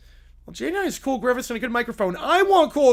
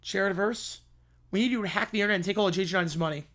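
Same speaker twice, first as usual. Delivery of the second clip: normal talking voice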